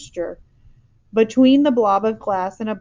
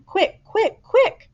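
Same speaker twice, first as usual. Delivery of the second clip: rising, higher each quick; urgent cry; 13 semitones higher, 5 dB louder, much slower than usual. A narrator's reading. Giant to the girl, urgently rushing. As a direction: hurry her, climbing in panic. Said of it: "he cried".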